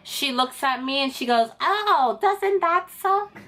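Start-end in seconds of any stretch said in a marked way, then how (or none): 1.6-3.3 high, drawn-out fake sympathy; taunting examiner impression, pitched way up; mock gloating. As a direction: gloat in a slow sing-song put-on voice. Mocking examiner gloats at failure.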